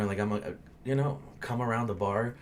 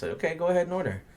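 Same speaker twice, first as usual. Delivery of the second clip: impatient; 8 semitones higher, 4 dB louder, faster than usual. Retold or imitated. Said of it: "I'm like".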